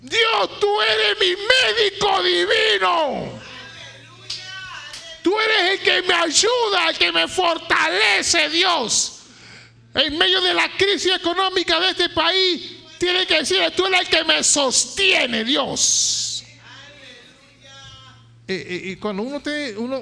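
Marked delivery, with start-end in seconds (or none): 0.0-3.4 shouted high and slow; full-throated shout to God; at the top of your voice. Shown as a demonstration. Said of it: "a voz en cuello". 5.2-16.4 loud, higher fervent preacher shouts; fiery sermon climax; preaching at full force. Acted.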